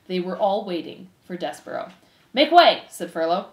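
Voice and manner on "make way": loud, raised-pitch crowd-clearing call. Acted. Furlow pushes through the mice, calling out. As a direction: call ahead to part the crowd.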